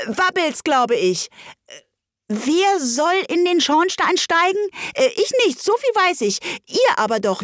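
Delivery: high, fussy little animal voices; rabbit and helpers. Acted. The rabbit bosses helpers, high and fussy. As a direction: bicker like small worried animals.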